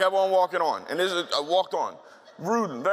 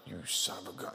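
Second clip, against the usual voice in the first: whispered aside to himself; rueful whisper, caught out; inner thought; 8 semitones lower, 11 dB quieter than usual. Retold or imitated. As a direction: breathe it under your breath. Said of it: "And I'm thinking".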